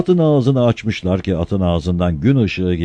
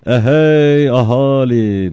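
slow, loud, higher crier's call; town crier call; 7 semitones higher, 8 dB louder, much slower than usual. Acted. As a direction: bellow it out to the square. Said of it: "diye başladı".

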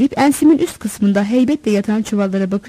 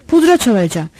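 plain reading voice

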